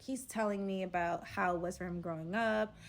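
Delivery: ordinary talk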